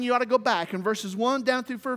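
ordinary talk

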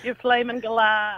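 put-on slow, drawn-out Aussie accent; Australian accent. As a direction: stretch it out in mock Australian. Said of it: "What about the Australian accent?".

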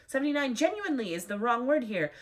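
ordinary talk, nothing marked

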